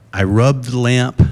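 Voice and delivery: ordinary delivery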